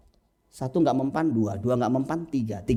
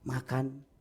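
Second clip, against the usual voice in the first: gentle coaxing; 11 dB quieter, much slower than usual. Acted. Parent calling a child to eat, gentle and soft. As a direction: coax a child softly to eat. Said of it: "nggak bisa lagi bahasa halus lagi".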